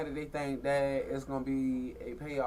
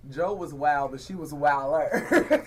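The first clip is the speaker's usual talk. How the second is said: normal talking voice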